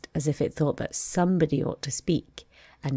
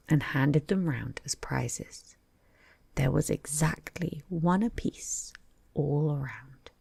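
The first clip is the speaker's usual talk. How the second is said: ordinary delivery, nothing marked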